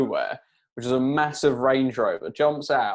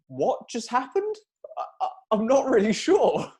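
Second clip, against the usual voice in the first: stunned disbelief; 9 semitones higher than usual. Retold or imitated. Retelling their stunned reaction.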